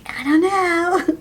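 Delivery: high, drawn-out dodge; hammed-up clueless whine; mock cluelessness. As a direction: stretch it out high, feigning no idea. Queen voiced dodging a question.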